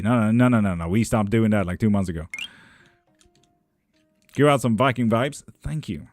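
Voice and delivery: ordinary talk, nothing marked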